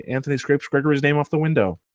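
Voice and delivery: ordinary talk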